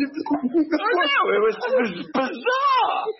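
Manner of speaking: high, slow words broken by laughter; laughing disbelief. Real, not acted. Recalling the chaos at the airport, laughing.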